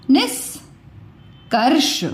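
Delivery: निष्कर्ष stretched out slowly, each syllable separate. Shown as a demonstration. बच्चों को र की ध्वनि सुनाने के लिए टीचर निष्कर्ष को धीरे-धीरे बोलती है. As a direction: draw out निष्कर्ष so the half r can be heard. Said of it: "र की ध्वनि आपको कहाँ सुनाई दी?".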